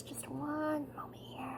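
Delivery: quick real whisper aside; hushed aside to viewers. Real, not acted. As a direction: breathe it, no voice. Stepping away to tend child.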